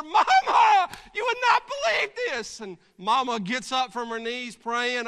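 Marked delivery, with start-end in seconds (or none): 0.0-2.6 high, loud, bursting with news; breathless excited news. Acted. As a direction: blurt it out as you burst in. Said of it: "busting through the door".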